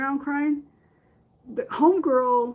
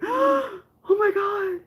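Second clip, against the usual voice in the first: high, slow gasped oh my God; overwhelmed gasp; 10 semitones higher, 4 dB louder, slower than usual. Pretend. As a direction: gasp as grief blindsides you. Sudden grief hits while walking.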